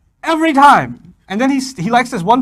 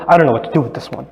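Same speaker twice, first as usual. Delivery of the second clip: low, fast fed-up dad; fed-up husband; 6 semitones lower than usual. Retold or imitated. Husband giving up on the lying kid, low and fast. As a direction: rattle it off low and weary. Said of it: "the husband comes on".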